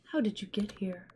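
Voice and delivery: put-on soft whispering old witch; whispery witch voice. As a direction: breathe the words like a gentle witch.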